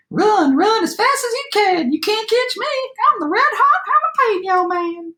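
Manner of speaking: high-pitched gingerbread cowboy voice; taunting cowboy shout. A narrator's reading. Gingerbread cowboy taunts his chasers, high boastful shout. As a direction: taunt them in a high, squeaky-high cry. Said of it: "shouted the gingerbread cowboy".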